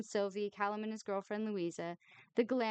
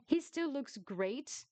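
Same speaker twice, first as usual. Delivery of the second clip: slow, high, dreamy sigh; swooning; 5 semitones higher, slower than usual. A narrator's reading. Miffy gazing at Dexter, swooning. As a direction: swoon over him. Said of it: "she sighed swooningly".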